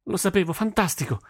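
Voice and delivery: high-pitched burst of delight; overjoyed. A narrator's reading. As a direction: cheer like a thrilled daughter. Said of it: "Nihal sprizzava gioia da tutti i pori". (gioia incontenibile)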